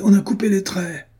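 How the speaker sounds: high, exclaimed discovery; cried alarm. A narrator's reading. Coachman discovering the cut traces, high cry of alarm. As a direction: cry out in startled outrage. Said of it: "cria le cocher".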